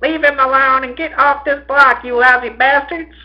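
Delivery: high, loud scolding woman; shrieked order from an upstairs window; angry yell. A narrator's reading. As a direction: yell down at a gang of boys. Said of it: "breasts yelled out".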